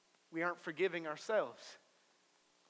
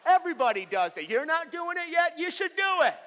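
building to high, loud, over-the-top urging; mock enthusiastic invitation; 11 semitones higher, 9 dB louder than usual. Wet passenger to staring strangers, mock enthusiastic shouting. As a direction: urge everyone to join in, hammed-up.

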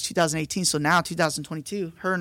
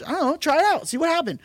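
bright, much higher, naive voice, a bit louder; childlike; 11 semitones higher, 3 dB louder, faster than usual. Retold or imitated. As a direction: sound like an eager kid trying something. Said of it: "we were so childlike".

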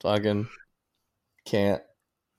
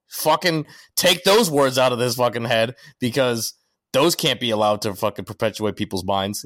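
ordinary talk, nothing marked

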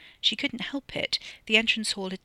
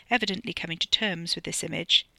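ordinary delivery, nothing marked

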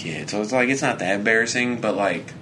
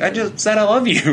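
exasperated protest; 11 semitones higher, 4 dB louder than usual. Retold or imitated. Exasperated, higher, louder.

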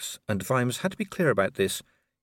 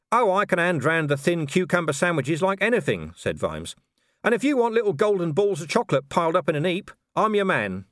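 fast, sardonic rattling-off; sarcastic Vimes; 7 semitones higher, 3 dB louder than usual. A narrator's reading. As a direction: rattle it off with mock eagerness. Vimes voiced in dialogue.

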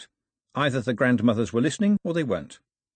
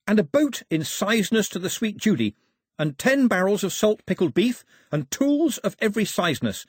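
high-pitched character reciting a wish list; eager islander; 9 semitones higher than usual. A narrator's reading. Pilou listing gifts for the king, eager. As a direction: rattle off wishes keenly, pitched high.

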